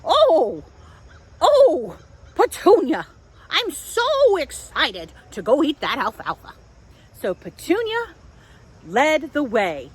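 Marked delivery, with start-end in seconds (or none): 0.0-6.5 high, loud, squealing with delight; a middle goat bouncing with joy; excited goat. Acted. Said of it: "And Tilly said".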